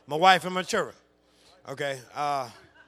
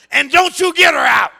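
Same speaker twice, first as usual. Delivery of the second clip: raised, loud warning shout; imitated angry wife; 13 semitones higher, 22 dB louder than usual. Wife warns husband on the couch, imitated angry shout. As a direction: order him sharply as the furious wife. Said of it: "she looks at me on the couch and says".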